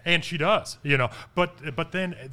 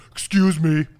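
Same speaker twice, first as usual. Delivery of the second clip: a loud, overly polite excuse me; faking manners, loud and innocent; mock polite; 11 dB louder, slower than usual. Student faking politeness to annoyed teacher, loud innocent excuse me. Kid fakes politeness after farting.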